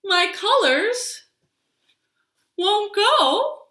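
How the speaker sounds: a tree telling its seasons, slow and expressive; tree character. Acted. Librarian reading a picture story to toddlers, storytelling voice. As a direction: read it as the tree, savouring each season.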